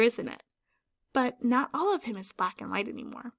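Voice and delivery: ordinary delivery, nothing marked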